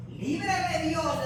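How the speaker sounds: loud, slow proclamation; drawn-out preacher's shout; forceful declaration. Acted. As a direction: cry it out slowly like a vow. Preacher crying out Naboth's refusal.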